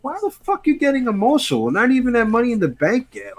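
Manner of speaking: high, incredulous retold rant; mock outrage. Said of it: "and I was like".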